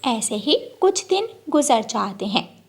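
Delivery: plain reading voice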